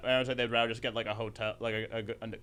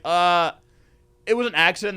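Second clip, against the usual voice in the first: raised, louder mock excuse; middle school girl; 4 semitones higher, 10 dB louder than usual. Caught girl excusing herself to the guard, mock protest. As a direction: imitate a girl making a lame excuse. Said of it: "she's like".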